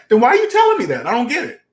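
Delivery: high, incredulous demand for explanation; puzzled challenge. Shown as a demonstration. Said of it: "That's how you say it".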